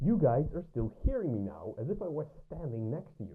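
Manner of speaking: muffled and distant, as if behind a wall; muffled from another room. Shown as a demonstration. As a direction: voice heard through a closed door, highs cut. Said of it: "it will start to sound muffled".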